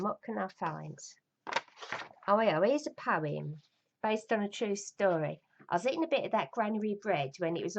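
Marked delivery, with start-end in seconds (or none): none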